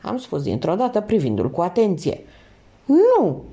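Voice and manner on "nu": sharp high startled cry; a high, loud startled no; exclaiming no. A narrator's reading. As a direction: blurt it out, shocked. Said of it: "exclamă el cu pupilele dilatate".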